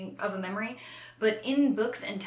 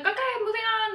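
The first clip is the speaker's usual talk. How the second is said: high, chirpy moving on; breezy brush-off; 5 dB louder, much faster than usual. Retold or imitated. Mocking the characters who ignore trauma, high and chirpy. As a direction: sing it out to skip the pain.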